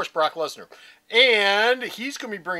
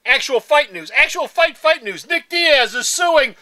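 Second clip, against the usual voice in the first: high, emphatic hype; mock-hyped news announcing; 10 semitones higher, 13 dB louder, slower than usual. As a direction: hit each phrase like breaking news, stretching the last.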